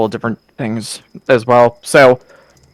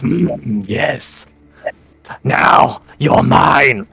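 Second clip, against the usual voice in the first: hissing lizard creature, slow taunt; drawn-out s like a snake; 6 semitones higher than usual. Acted. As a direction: stretch the s like a snake. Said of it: "this lizard foe goes".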